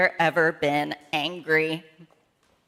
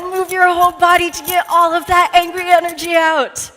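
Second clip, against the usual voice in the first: rising higher and louder toward a near shout; building excitement; 8 semitones higher, 8 dB louder than usual. Shown as a demonstration. Leader gets kids moving all over, building to an excited near shout. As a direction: wind up the whole body until it bursts. Whole-body wiggle demo, building.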